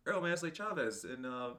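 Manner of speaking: lightly voiced workman boasting; half-laughing mock boast; hinted worker voice. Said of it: "with an accent I'm not gonna do".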